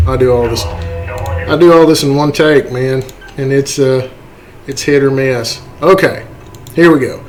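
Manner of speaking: ordinary delivery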